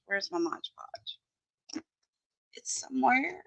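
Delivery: softly, searching for the glue; muttered to herself. Real, not acted. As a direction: mumble it while looking around.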